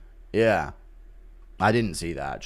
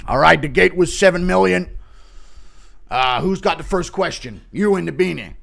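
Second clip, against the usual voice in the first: press conference host; 4 semitones higher, 3 dB louder than usual. Acted.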